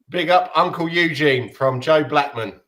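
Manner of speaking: ordinary talk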